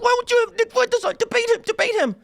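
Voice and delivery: very high, nasal-sounding mock whine; shrill mocking imitation of his critics; mock whiny tweeters. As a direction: squeal it like a crybaby online. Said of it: "they're tweeting up, like".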